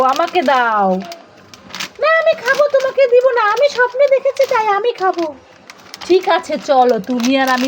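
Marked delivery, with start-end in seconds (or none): 2.0-2.7 put-on squeaky high little-kid voice, insisting; high child figure. Toy child refusing mother, in a high squeaky voice. 2.7-5.3 higher pretend-kid voice, claiming the chips; a light squeaky kid voice, not sharing; stubborn child figure. Toy child keeping the chips, in a lightly raised kid voice.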